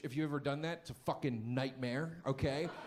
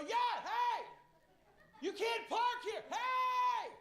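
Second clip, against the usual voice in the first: much higher mock yell at a parked driver; slower than usual. Comic retelling honking at the driver, mock yell. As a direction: yell through closed windows at the driver. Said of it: "I'm yelling".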